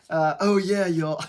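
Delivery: Keanu Reeves voice. Retold or imitated. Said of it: "my Nick Cage impression turns into my Keanu Reeves impression".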